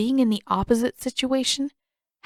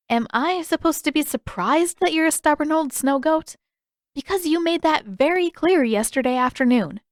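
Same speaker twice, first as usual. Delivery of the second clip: sarcastic retort; 8 semitones higher than usual. Acted. Katara mocks Pakku.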